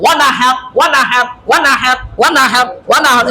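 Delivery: ওয়ানাহার with a bouncing, bubbling nun; bubbling echo sound. Shown as a demonstration. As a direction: let ওয়ানাহার ripple like a gurgle. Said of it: "এরকম যেন আওয়াজ হয়". (কলকলার মতো ওয়ানাহার)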